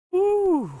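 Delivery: loud high whoop of joy; excited cheer. Real, not acted. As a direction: cheer like you just won. Cheering after finishing the game.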